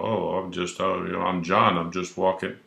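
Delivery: nervous mumble. Retold or imitated. Citizen meekly answering the officer, soft and hesitant. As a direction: caught off guard, quietly stammering.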